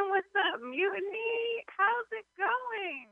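high, slow hype-man call; mock MC greeting. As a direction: stretch it out like a stage host.

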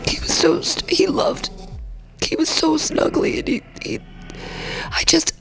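choked up, voice breaking high; real crying. Real, not acted. Host missing a dead cat on air, tearful and breaking. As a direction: grieve through tears, words catching and thinning. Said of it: "I'm fucking crying about".

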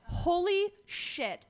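slow, high drawn-out astonishment; stunned. Real, not acted. Recalling rewatching Sailor Moon, slow stunned exclamation. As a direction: let the shock stretch out.

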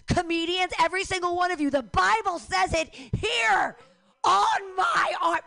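shouting at comedians. Real, not acted. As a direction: preach it at them, full volume. Preaching at the crowd.

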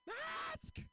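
loud, high scream of one word; shrill-pitched mock outrage, drawn out; imitated scream. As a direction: scream it at someone a hundred yards away. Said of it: "pulls down her mask and screams".

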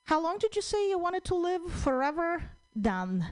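lightly voiced genie, teasing; genie's sly reply. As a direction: genie dodges the wish, sly. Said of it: "and then he said".

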